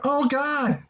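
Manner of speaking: retold groaning-with-delight reaction; amused disbelief. Fan reliving the Doctor Thuganomics reveal, amused high disbelief.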